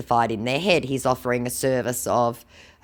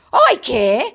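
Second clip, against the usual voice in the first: hasty reply; 11 semitones higher, 9 dB louder than usual. Retold or imitated. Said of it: "came his hasty reply".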